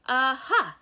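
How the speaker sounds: put-on a baby crowing at the villain; triumphant cry. A narrator's reading. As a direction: crow it in triumph. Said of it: "Sunny cried".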